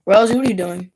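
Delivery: loud, sudden call to the dog. Real, not acted. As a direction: call the dog out on its misbehaviour, loudly. Calls out to the dog.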